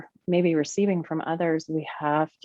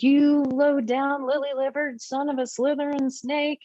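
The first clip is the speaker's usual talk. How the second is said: enraged yell; 8 semitones higher than usual. Acted. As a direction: play the enraged part laying into the beloved. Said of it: "an enraged part that gets big and yells".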